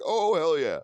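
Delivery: loud, high, eager hell yeah; enthusiastic yes. Retold or imitated. Pilot boasting to the comedian, loud and eager. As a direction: boast it happily. Pilot proudly admits he fights.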